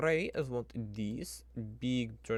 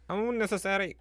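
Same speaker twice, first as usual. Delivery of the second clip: unnecessary yelped out much higher and louder; higher, louder mock-indignant repeat of unnecessary; exasperated outburst; 9 semitones higher, 8 dB louder than usual. Shown as a demonstration. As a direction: fling the word unnecessary out in disbelief. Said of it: "It's unnecessary".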